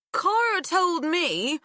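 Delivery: slurred, slow tipsy speech; drunk slurring. Acted. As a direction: slur the word edges like you're drunk. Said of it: "slurring the edges of her words".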